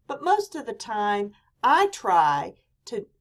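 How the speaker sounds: ordinary delivery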